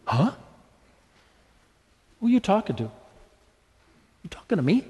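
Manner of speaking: taken aback, with long pauses; questioning, as if it can't be meant for you; acted disbelief. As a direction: play the stunned double take. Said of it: "we're just gonna go".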